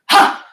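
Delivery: sharp loud karate kiai; karate yell. Shown as a demonstration. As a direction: bark out a karate chop shout. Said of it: "make that karate chop sound".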